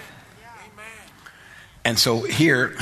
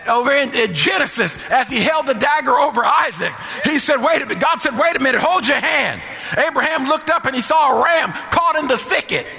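high, sustained, shouted sermon delivery; full-force preaching; 13 semitones higher than usual. Real, not acted. Preacher rousing the congregation to confidence, fervent high-pitched preaching. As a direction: ring it out in rousing, driving phrases.

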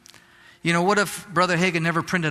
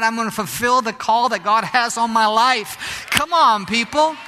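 raised, driving sermon voice, pushing the congregation on; preaching at full force; 6 semitones higher, 4 dB louder than usual. Acted. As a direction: preach it out loud and fervent, urging them not to quit. Preacher drives home persevering.

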